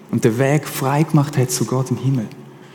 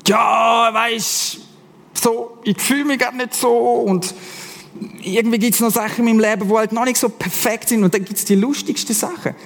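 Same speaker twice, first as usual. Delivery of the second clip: hesitant excuses; 6 semitones higher than usual. Retold or imitated. Hesitant people give excuses.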